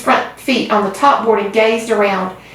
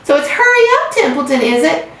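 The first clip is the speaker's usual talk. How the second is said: high mocking copy of the pig; much higher sneering impression of Wilbur; imitating Wilbur; 8 semitones higher than usual. A narrator's reading. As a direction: parrot Wilbur's plea sarcastically. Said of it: "began imitating Wilbur's voice".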